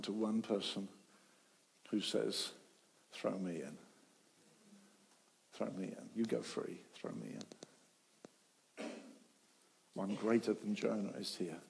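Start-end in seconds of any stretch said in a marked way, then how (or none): 3.1-6.8 soft, low surrender; quiet offering. Retold or imitated. One person offering himself, softly. 6.9-8.9 whispered, very slow and low; whispered surrender. Retold or imitated. The one who gives himself, a slow, low whisper. Final hushed repetition.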